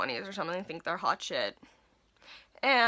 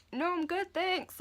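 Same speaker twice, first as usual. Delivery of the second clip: polite refusal; 9 semitones higher, slower than usual. Retold or imitated. Declining a customer's creepy offer.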